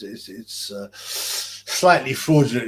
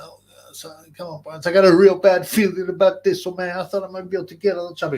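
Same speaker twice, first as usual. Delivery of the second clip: nervous soldier pleading; 4 semitones higher, 6 dB louder, faster than usual. Retold or imitated. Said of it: "and he's like".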